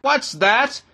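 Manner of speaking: high, sharp query; indignant interruption. A narrator's reading. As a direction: cut in, outraged. Bishop interrupts the reading, indignant.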